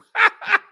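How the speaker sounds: a quick, high burst of laughter; sharp laugh. Real, not acted. Host laughs at a viewer's double dipping joke, short high laugh. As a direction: let one laugh pop out.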